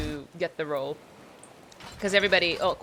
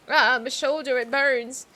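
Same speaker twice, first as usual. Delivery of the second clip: wounded sailor pleading; 5 semitones higher, 6 dB louder than usual. Acted.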